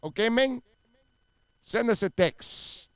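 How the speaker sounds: put-on a playful Miami accent as the host opens hour four. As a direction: lay on a Miami accent, like a hyped radio host. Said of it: "You're just doing an accent".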